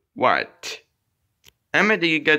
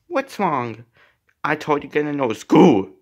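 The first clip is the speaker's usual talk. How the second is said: ordinary talk, nothing marked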